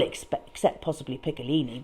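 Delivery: usual speaking voice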